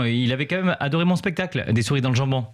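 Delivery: usual speaking voice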